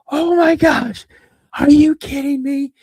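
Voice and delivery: very high, loud mock astonishment; mocking disbelief. Teenage son laughs at dad's otter story, mocking disbelief. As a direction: squeal it loudly, ridiculing the tale. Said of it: "I started laughing even harder".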